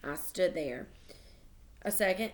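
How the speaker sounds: usual speaking voice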